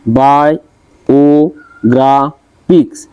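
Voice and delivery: বায়োগ্রাফিক্স slowly split as বা, য়ো, গ্রা, ফিক্স; four drawn-out beats on বায়োগ্রাফিক্স; syllable by syllable. Shown as a demonstration. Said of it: "চারটা দেওয়া আছে".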